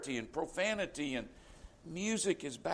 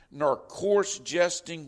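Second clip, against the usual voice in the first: "coarse" leaned on, slow and louder, falling; solemn scripture reading; 5 dB louder, slower than usual. Acted. Preacher reading the verse aloud to the congregation, slow and weighty. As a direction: intone "nor coarse jesting" as holy writ. Scripture quoted, then repeated plainly.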